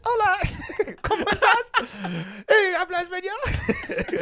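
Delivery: high, slow, giggling mock greeting; squeaky voice through laughter. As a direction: read the text in her squeaky voice, cracking up. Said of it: "a text message that says".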